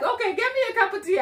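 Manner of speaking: quick, high-pitched mock perkiness; playfully rushed, high and chirpy; breezy acted relief. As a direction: rattle it off as if suddenly all sorted. Acts out own sudden relief.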